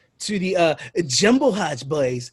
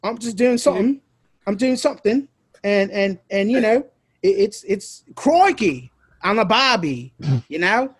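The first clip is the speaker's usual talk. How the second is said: put-on Aussie accent; 4 semitones higher than usual. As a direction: ham it up like a cartoon Australian. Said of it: "not offended with my accent".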